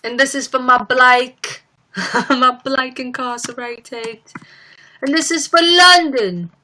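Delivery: put-on high London accent building to loud. Streamer imitating the singer's Grammy dedications, London accent. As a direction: dedicate it in London accent, last one biggest. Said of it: "her Grammy speech where she was like".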